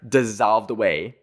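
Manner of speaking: ordinary talk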